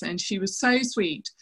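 normal talking voice

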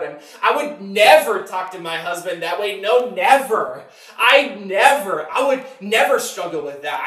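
loud, stretched "never"; overconfident vow. Retold or imitated. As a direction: imitate someone swearing they would never. Voices overconfident spouse's vow.

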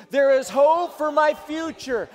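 slow, loud proclamation; shouted declaration. Shown as a demonstration. Pastor leading a loud congregational shout. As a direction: shout it out for all to hear. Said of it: "One, two, three".

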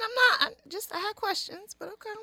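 high, put-upon okay; mock wounded. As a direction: pretend to be hurt and resigned. Mock hurt reaction.

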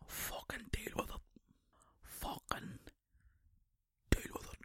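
soft, whispered aside. Real, not acted. Telling listeners about the audio, whispered. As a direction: breathe it quietly.

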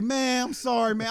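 high pleading voice; thief apologizing. Retold or imitated. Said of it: "He's like".

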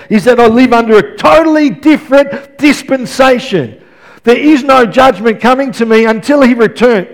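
loud, driving sermon delivery; impassioned preaching. Real, not acted. Preacher telling church about a new dispensation, impassioned preaching. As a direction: preach it out with building conviction.

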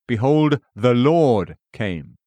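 slow, with "Lord" drawn out; stress on LORD. A narrator's reading. Narrator quoting Enoch's words, stress on "Lord". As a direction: lean long on "Lord" before "came". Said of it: "emphasis added".

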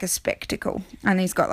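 normal talking voice

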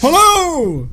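high, loud holler; calling out. Retold or imitated. Fan acts out the Grinch calling to his echo, hollering. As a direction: call out into an echoing space. Acting out a shouted echo scene.

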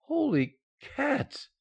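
high, slow, stunned exclamation; stunned gasp. Acted. Husband stunned by news of triplets, slow and high. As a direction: drag it out in disbelief.

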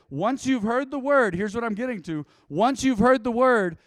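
loud, high, insistent proclamation; emphatic preaching. Real, not acted. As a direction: drive the point home to the congregation. Preacher drives home main point.

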